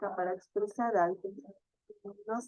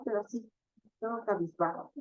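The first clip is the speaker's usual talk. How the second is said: ordinary talk, nothing marked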